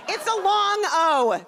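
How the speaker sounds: high, loud, slow, stretching long O; stressing long O. Retold or imitated. Correcting her teacher, a high, slow long O. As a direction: drag out the long O to correct him. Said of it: "I'm like".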